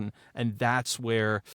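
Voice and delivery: ordinary talk, nothing marked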